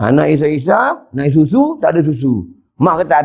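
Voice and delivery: ordinary talk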